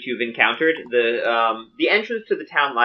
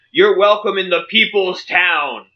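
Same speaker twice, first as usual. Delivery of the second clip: welcoming guard; 7 semitones higher, 3 dB louder, slower than usual. Acted. Guard welcomes party, slow and loud.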